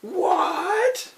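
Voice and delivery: high, loud furious yell; angry shout. Acted. Editor hears the hot scoop, furious high shout. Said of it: "calm and businesslike manner".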